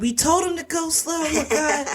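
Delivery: mock panicked squeal. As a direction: squeak it out like a nervous backer. Retold panic of investors.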